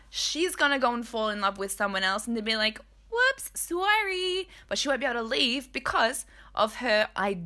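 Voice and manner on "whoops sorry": high, slow, singsong sorry; mock apology. Imagined cheating wife telling her husband, mock apologetic. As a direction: draw out a hammed-up, flippant apology.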